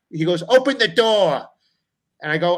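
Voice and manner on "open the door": stern demand. Retold or imitated. Dad demanding at locked door.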